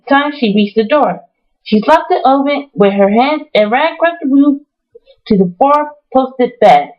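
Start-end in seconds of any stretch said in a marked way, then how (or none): none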